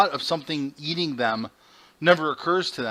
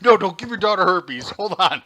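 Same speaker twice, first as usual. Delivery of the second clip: mock alarm; 7 semitones higher, 9 dB louder than usual. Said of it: "I'm like".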